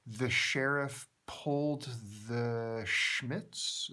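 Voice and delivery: slow, careful reading of a reversed phrase; backwards speech. Shown as a demonstration. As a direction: spell out the heard words slowly. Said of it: "what I think I hear her saying is".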